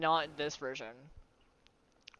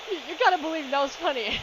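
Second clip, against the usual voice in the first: laughing plea; 8 semitones higher, 3 dB louder, faster than usual. Real, not acted. Pleading through laughter.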